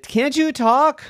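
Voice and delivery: high, quick piggy voice. A narrator's reading. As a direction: squeal it out like a curious pig. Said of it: "Huxley said".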